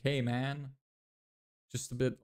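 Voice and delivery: usual speaking voice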